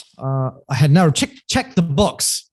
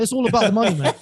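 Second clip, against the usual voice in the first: laughing dad imitation; 8 semitones higher, much faster than usual. Dad insisting it's about money.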